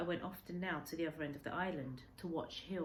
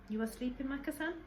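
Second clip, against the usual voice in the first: higher, rising question; old man asks gently; 9 semitones higher than usual. Acted. Old man asks the boy if he sleeps, lightly voiced. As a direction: check if the boy is awake.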